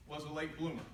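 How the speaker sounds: ordinary talk, nothing marked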